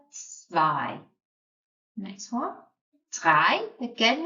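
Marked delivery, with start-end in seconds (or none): 0.1-1.0 zwei said tsvai, falling; German zwei. Shown as a demonstration. Teacher demonstrating the blended German zwei. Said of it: "Put it together".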